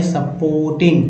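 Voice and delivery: "सपोर्टिंग" धीमे साफ़ उच्चारण में; slow "सपोर्टिंग", सुपोर्टिंग; careful pronunciation. Shown as a demonstration. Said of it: "ओ आर को अर बोलते हैं". (धीरे स्पष्ट "सपोर्टिंग")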